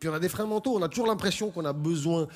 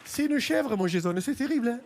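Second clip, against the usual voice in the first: Mireille losing it. Acted. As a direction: shriek like a fed-up single mom at a protest. Mireille keeps ranting.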